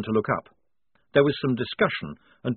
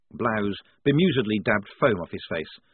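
usual speaking voice

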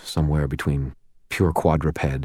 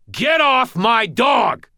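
high, loud, drawn-out shout; furious scream; 5 dB louder, much slower than usual. A narrator's reading. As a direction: scream each word slowly at the attacker.

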